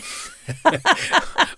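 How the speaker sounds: bubbling burst of laughter. Real, not acted. Wife cracking up over teasing her husband, laughter. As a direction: laugh helplessly at your own teasing.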